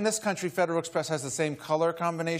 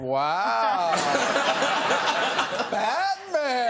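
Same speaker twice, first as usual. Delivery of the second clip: high, laughing gasp of wonder; mock awe; 6 semitones higher, 3 dB louder, much slower than usual. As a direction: play the starstruck parents, breathless with laughter. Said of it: "kind of going".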